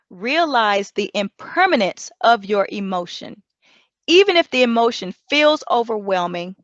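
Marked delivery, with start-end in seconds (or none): none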